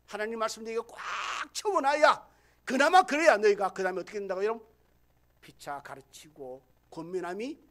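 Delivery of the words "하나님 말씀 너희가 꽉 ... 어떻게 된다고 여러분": loud, driving pulpit delivery; full-force preaching. Acted. Pastor preaching hard to his congregation, loud and driving. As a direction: press each point on the congregation.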